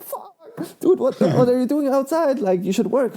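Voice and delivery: high-pitched mock scolding; exasperated boss. Boss catches worker outside on a call, mock scolding. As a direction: imitate a boss telling someone off.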